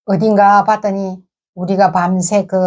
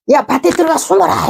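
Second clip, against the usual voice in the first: high, urgent call to take cover; mild yell of command, pitched up; retold shouted order; 7 semitones higher than usual. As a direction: call out a hurried warning to the group. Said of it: "이렇게 소리를 질렀더니".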